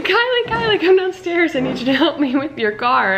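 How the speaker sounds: high-pitched mock yell, easing off toward the end; imitated mom yelling. Mom calling her daughter downstairs for help, imitated yelling. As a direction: call upstairs like an exaggerated frantic mom. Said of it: "my mom yelling at me".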